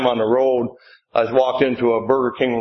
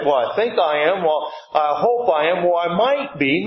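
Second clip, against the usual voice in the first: unsure; 4 semitones higher than usual. Retold or imitated. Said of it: "Not just like".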